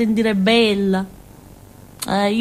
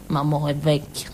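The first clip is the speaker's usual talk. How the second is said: usual speaking voice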